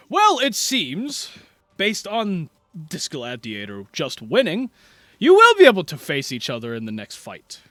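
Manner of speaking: projected announcer voice with loud swells; tournament announcer. Acted. Announcer telling fighters to wait a round, loud and projected. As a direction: proclaim it, leaning hard on the openings. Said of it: "hear the announcer go".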